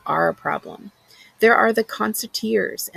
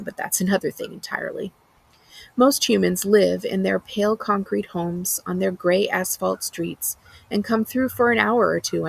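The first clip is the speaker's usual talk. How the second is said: plain reading voice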